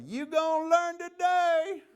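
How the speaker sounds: high, slow, loud taunting yell; mock yell. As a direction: drag it out and holler it for everyone to hear. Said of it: "that canine handler yelled out".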